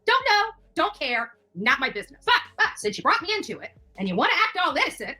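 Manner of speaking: ordinary talk, nothing marked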